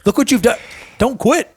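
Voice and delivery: high-pitched, lightly acted coach's plea; urgent pep talk. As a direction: plead with an imagined client to keep going. Coach urging imagined quitting client.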